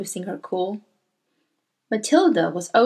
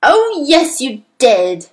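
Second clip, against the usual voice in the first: loud, swooping contradiction; loud retort, high start then falling; roaring back; 4 semitones higher, 8 dB louder, slower than usual. A narrator's reading. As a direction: roar the accusation back at her. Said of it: "the Trunchbull roared back".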